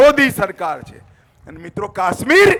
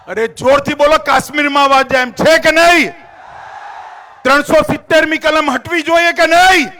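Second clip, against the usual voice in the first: rousing shout; 4 semitones higher, 4 dB louder than usual. Real, not acted. Speaker to rally crowd, demanding a loud answer, shouting.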